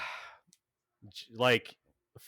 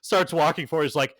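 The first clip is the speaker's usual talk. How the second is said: ordinary delivery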